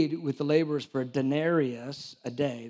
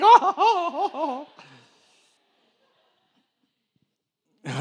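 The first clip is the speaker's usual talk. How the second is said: very high, loud laughing ooh-ooh cries; hammed-up primitive hooting; shrieking mock grunts through laughter; mock caveman grunts; 12 semitones higher, 9 dB louder, slower than usual. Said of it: "I should have said".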